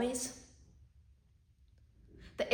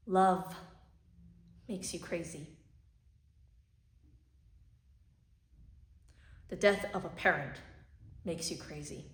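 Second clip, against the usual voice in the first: soft, hushed grief; tragic heroine sorrow; 3 semitones lower, 7 dB quieter than usual. Acted. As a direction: speak low as if mourning. Monologue grieving for Ani.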